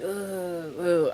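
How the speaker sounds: mock stomachache groans; fake groans. Pretending to be sick to fool mom, fake groans. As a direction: groan like your stomach hurts. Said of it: "I'm just making stupid ass noise".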